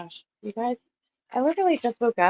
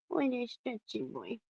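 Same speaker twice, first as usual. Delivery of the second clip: soft, slow, higher-pitched cooing; 4 semitones higher, 11 dB quieter than usual. Real, not acted. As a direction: coo gently to a dog, drawing it out. Baby-talking to the dog.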